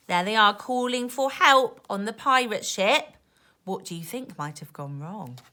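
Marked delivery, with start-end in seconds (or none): none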